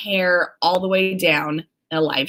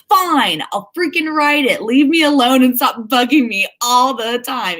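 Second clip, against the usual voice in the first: loud, raised, fed-up outburst; exasperated giving in; 3 semitones higher, 4 dB louder than usual. Acted. Writer to a story that haunts her, exasperated giving in. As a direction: give in loudly to a pest. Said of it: "until you're like".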